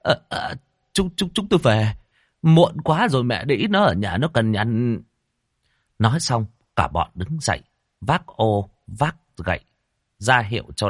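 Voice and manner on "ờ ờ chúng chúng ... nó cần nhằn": high stammering excuse; flustered guest backing out; nervous stammering. A narrator's reading. Guest nervously excuses himself to go home.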